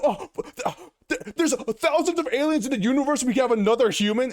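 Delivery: high-pitched, stammering mock complaint; mock outrage. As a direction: sputter like an indignant fan, hammed-up. Mocks fans upset at casting.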